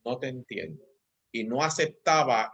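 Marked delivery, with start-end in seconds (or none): 0.0-0.8 put-on calm to child. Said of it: "le decía".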